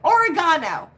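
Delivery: Oregano said or-uh-GAH-no, nasal GAH, falling; mispronounced oregano. Retold or imitated. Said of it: "I heard somebody say, pronounce it".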